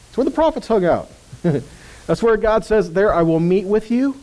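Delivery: ordinary talk, nothing marked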